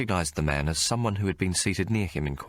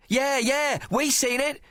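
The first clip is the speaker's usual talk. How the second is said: put-on much higher street-kid yell; urchin yelling; slower than usual. Sparrowfart yelling to the court from the gallery, high-pitched. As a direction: back up your mate at the top of your voice. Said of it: "yelled at the top of his voice".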